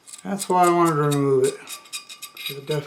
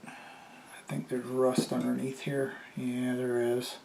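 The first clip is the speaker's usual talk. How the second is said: whispered aside; 8 dB quieter than usual. Real, not acted. Muttering while inspecting.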